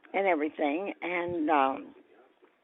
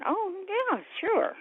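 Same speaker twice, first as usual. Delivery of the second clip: eager yes. Retold or imitated. Eager reply to Lou.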